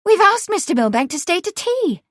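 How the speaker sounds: put-on high, loud girlish protest; hurried objection. A narrator's reading. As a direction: plead the point in a high voice. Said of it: "Eleanor objected hurriedly".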